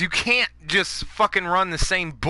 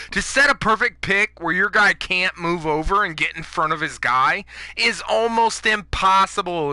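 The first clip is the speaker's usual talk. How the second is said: usual speaking voice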